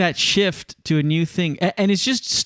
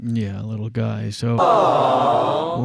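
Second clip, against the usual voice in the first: tender; 5 semitones lower, much slower than usual. Real, not acted. Cooing at the sleeping dog.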